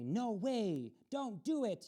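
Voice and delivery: plain reading voice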